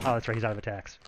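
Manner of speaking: usual speaking voice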